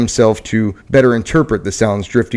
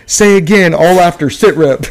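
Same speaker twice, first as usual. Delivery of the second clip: raised, hurried radio reply; crackly military radio; 7 semitones higher than usual. Acted. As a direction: ask again over the handset. Murmured radio reply under fire.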